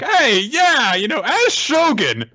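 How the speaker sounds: high, loud self-important claim; pompous villain. Retold or imitated. Orochi boasting to Hiyori, loud pompous. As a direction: puff up like a fake Shogun. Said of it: "Orochi's like".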